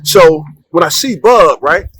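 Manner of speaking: ordinary delivery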